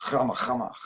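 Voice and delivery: "Rama" with a scraped Dutch throat r; Dutch-accented guttural "Rama". Shown as a demonstration. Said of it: "Or if you're from Holland".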